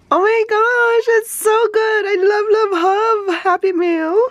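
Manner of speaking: high, squealing love for the mail; giddy high-pitched gushing; high gushing delight. Real, not acted. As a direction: squeal it, overflowing joy. Gushing over happy mail.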